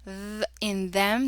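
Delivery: slow voiced "th" buzz before "them"; hard TH. Shown as a demonstration. Teacher repeating the special sound, hard voiced TH. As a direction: buzz the "th" on its own, then give "them". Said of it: "This is the hard T H sound".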